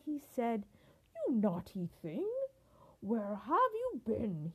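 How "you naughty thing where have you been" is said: mildly thundering scolding; a touch of royal anger; thundering king. A narrator's reading. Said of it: "he thundered".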